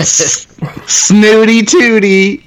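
very high, slow mock-posh; snooty voice. Teasing mock-posh retort.

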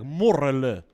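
"morrelen" loud with a rolled double R; demonstrated trill in "morrelen"; emphasised R. Shown as a demonstration. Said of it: "maar morrelen".

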